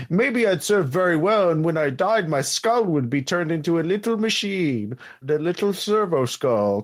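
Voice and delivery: rambling clerk in a put-on accent; Hunby. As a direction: chatter on as an eager, faithful little functionary. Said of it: "having a moment with this accent".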